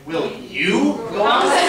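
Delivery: "me" hit with a rising, laughing lift; laughing ask that rises up on "me"; stress on ME. Shown as a demonstration. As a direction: save the weight for "me". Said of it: "on the emphasis on a different word".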